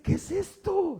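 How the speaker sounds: high, loud alarmed cry; frightened medium. Retold or imitated. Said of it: "y dice". (la médium grita asustada, mucho más aguda)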